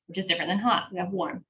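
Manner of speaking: ordinary talk, nothing marked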